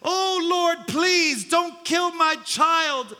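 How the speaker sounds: high, desperate begging; wailing plea of a mother; pleading mother. Acted. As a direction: beg for your child's life. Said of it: "she begged and pleaded".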